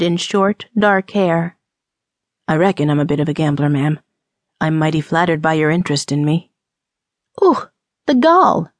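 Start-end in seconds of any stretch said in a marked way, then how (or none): 2.5-6.5 low, quick drawling cowboy charmer; flirtatious gambler. Acted. Gambler to woman asking his profession, low and flirtatious. 7.4-8.7 high, drawn-out outraged gasp of words; indignant. Acted. Woman to flirting gambler, high and indignant.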